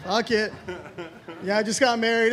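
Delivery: ordinary talk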